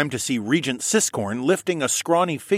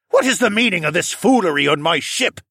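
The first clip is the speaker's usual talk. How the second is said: loud, raised captain's demand; commanding and authoritative; 8 semitones higher, 6 dB louder than usual. A narrator's reading. Captain confronting two armed mobs, commanding and authoritative. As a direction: demand answers as master of the ship. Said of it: "to commanding and authoritative".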